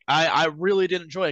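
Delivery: usual speaking voice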